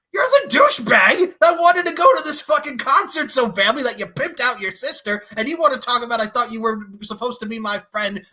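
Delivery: high, loud ranting shout; indignant outburst. Acted. Telling off the douchebag friend, indignant shout. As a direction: yell it, appalled at this so-called friend.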